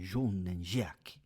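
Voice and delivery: John and Jack with a mock French accent, slow; slow, hammed-up French accent. Shown as a demonstration. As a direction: drawl the names in a French accent. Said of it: "say it with like a French accent".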